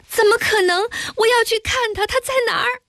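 very high-pitched frantic pleading; distraught disbelief. A narrator's reading. Feigned distraught reaction to death news. (高声难以置信地急切追问)

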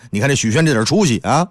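ordinary delivery

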